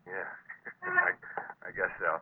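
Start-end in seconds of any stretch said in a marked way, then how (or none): 0.8-1.2 brief high laugh; rueful chuckle; short laugh. Acted. Laughs off the danger.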